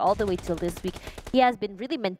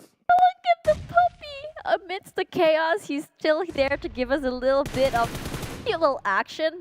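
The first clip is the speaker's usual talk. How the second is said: much higher, cutesy voice for the dog; cooing at puppy; 7 semitones higher than usual. Real, not acted. Caster spots a puppy amid the chaos, high delighted coo. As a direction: coo over the puppy, pitched way up.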